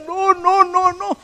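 high, pleading mock whimper of a teenage girl; near tears. A girl near tears pleading with her teacher, mock whimper. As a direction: whine no no like a girl about to cry. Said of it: "casi que llorando le decía".

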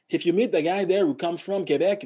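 English with a Montreal francophone accent; Quebec French accent. Shown as a demonstration. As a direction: demonstrate a Québécois speaking English. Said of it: "francophones from Canada".

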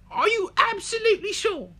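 piercingly high English cub, insistent; English baby polar bear. Acted. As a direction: plead in a squeaky, bad English accent. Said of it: "It lets me do a horrific accent".

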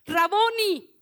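Mary recognising Jesus, exclaiming in joy. A narrator's reading. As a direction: call out "Raboni" in sudden recognition. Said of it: "y exclamó".

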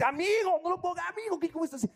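excited friend. Retold or imitated.

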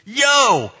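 high, loud holler, slowing at the end; brash mock child's shout; imitated kid calling heavenward. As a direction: call up to heaven like a bold little boy. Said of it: "he looks up at the ceiling".

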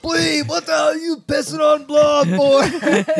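high, loud, slow bellow; coach's outraged yell. Retold or imitated. Coach walks by and catches Bleeb, outraged yell. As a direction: holler like a coach catching the culprit.

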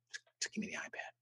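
near-whispered, fast and terse; hushed request. Retold or imitated. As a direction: whisper it urgently under your breath. Him answering wife, hushed.

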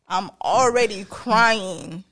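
"already crying" drawn out slow and loud; mock exasperated child. Child answers threatening parent, "already crying" stretched in exasperation. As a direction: lean slowly and loudly on "already".

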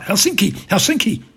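loud, high, agitated cries; crying out. Acted. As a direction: wake up calling it out in alarm. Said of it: "Trump crying out".

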